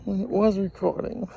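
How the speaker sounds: usual speaking voice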